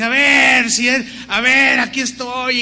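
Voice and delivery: loud, high mocking challenge thrown at the sky; defiant shout. Former satanist taunting God during a ritual, defiant mock shout.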